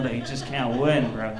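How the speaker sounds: ordinary delivery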